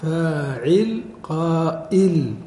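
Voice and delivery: "فاعل", "قائل" slow, both with long first syllables; pattern matching demonstration. Shown as a demonstration. Teacher matching hamza to ayn, "فاعل، قائل" slowly stretched. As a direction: draw out "فاعل" then "قائل" in parallel syllables. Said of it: "فجعلوا الهمزة مقابل العين".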